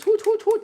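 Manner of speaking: high toy owl hooting; owl hoots. Retold or imitated. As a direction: hoot like a little robot owl. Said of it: "He goes".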